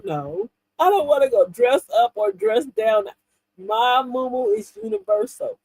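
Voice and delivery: ordinary talk, nothing marked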